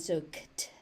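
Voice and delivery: "kt" whispered as a bare final cluster; cluster sound only. Shown as a demonstration. Teacher to learners, isolating the final "kt" cluster. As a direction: breathe out just the "kt" of the word end. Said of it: "consonant cluster at the end".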